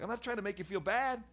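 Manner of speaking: high, pleading denial; defensive protest. Retold or imitated. Boy protesting to bullies, high and pleading. His retold protest, much higher.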